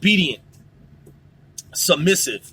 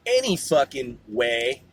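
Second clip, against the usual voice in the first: usual speaking voice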